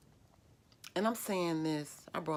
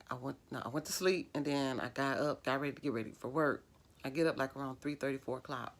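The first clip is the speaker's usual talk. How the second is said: ordinary talk, nothing marked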